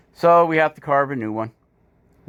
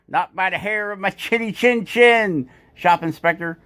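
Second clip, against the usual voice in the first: higher, hammed-up storybook voice; mock big bad wolf reply; 6 semitones higher than usual. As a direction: tease the dog like in the fairy tale. Answers dog with fairy-tale line.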